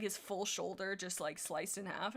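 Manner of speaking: ordinary delivery, nothing marked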